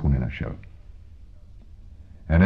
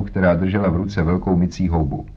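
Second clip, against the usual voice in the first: usual speaking voice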